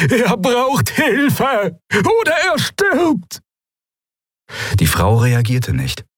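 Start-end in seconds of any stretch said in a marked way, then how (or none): 0.1-3.4 high, slow despairing cry; desperate shout. Acted. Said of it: "so laut ... so verzweifelt".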